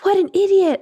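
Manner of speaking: high-pitched imitation of a small boy; six-year-old's blurt. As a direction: quote a kid blurting it out. Voicing her six-year-old son.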